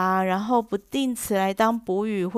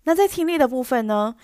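ordinary talk, nothing marked

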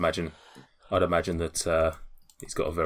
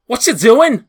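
high, loud shout through a door; 15 dB louder than usual. Acted. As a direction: shout a suspicious challenge from the other side. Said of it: "a shouting voice".

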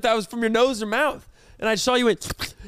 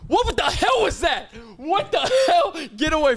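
disgusted shock; 6 semitones higher than usual. Real, not acted. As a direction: recoil in shock, voice jumping up. Disgusted at friend's drool.

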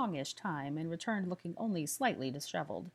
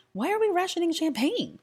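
high shocked gasp; alarmed, pitched way up; 13 semitones higher, 9 dB louder than usual. A narrator's reading. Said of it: "gasped Pansy".

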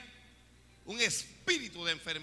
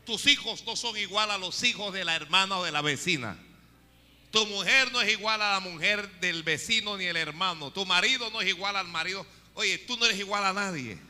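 loud, fervent pulpit call; full-force preaching; 7 dB louder than usual. Acted. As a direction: hammer every line at the congregation.

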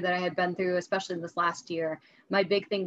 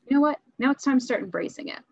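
determined resolve; 6 semitones higher, 3 dB louder, faster than usual. Retold or imitated. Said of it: "I'm like".

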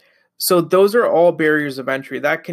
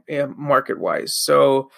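ordinary delivery

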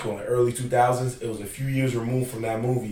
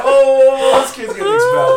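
high, loud imitated student uproar; shocked reaction; 12 semitones higher, 12 dB louder than usual. Students watching classmate heckle the principal, shocked imitated outcry. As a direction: exclaim it like a scandalized onlooker.

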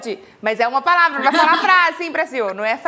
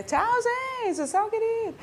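mock hasty goodbye; 8 semitones higher, 5 dB quieter than usual. Mocking a hurried goodbye to family.

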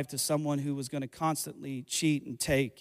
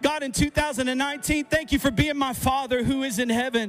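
louder, soaring prayer of thanks; fervent prayer; 7 semitones higher, 4 dB louder than usual. Acted. Preacher praying thanks to God, building fervent intensity. Closing prayer at full force.

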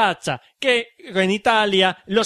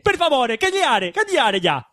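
higher, playful fake Italian gibberish; mock Italian accent; 8 semitones higher than usual. Host babbling fake Italian at his friends. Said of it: "con acento italiano y haciendo coña".

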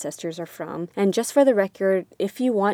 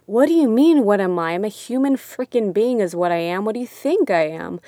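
indignant retort; 5 dB louder than usual. Retold or imitated. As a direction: fire back, affronted, then ease off slightly. Indignant retort to insulting question.

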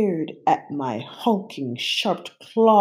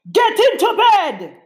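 loud booming shout; thundering; 7 dB louder than usual. A narrator's reading. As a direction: thunder it at her. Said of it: "Gabe thundered".

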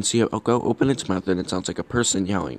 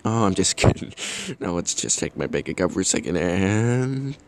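ordinary talk

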